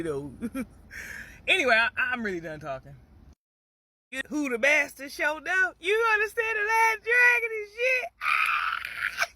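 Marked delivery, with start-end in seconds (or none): 4.3-9.3 excited call-out. Real, not acted. Excited call-out about The Last Dragon.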